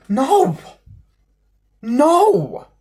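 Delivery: high, loud outraged no; emphatic high no. Real, not acted. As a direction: shout it down, pitch shooting up. Emphatic denial in rant.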